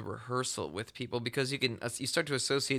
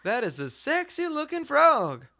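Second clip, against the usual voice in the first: mock-sultry admiring; 13 semitones higher, 6 dB louder than usual. Real, not acted. Cooing over a frog picture.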